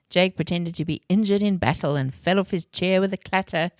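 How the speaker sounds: usual speaking voice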